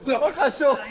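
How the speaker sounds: loud gloating laugh; laughing shout. Real, not acted. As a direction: crow it out through laughter. (gritando e rindo)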